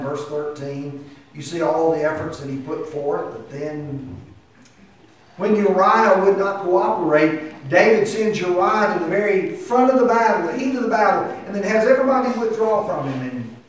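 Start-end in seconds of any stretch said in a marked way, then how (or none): 5.4-13.6 raised, high preacher's delivery; forceful preaching. Real, not acted. Preacher telling congregation of Uriah's betrayal, forceful and raised. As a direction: drive the story home with preaching force.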